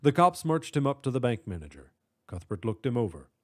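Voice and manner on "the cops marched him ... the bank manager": usual speaking voice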